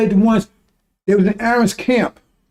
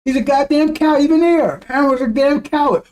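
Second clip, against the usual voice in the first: ordinary talk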